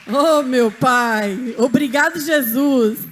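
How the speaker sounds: loud exclamation, opening drawn out; overwhelmed thanks. Real, not acted. Woman receiving a gift thanks Jesus, loud and overwhelmed. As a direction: cry out gratitude to God.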